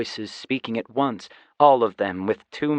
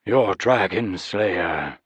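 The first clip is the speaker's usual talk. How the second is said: somber knight; 3 semitones lower, slower than usual. Acted.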